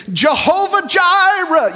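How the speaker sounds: high, slow, loud pious intoning; mock grand invocation. Preacher parodies grand titles for God, mock grand. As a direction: intone the holy name with overblown reverence. Mocking Old Testament prayer titles.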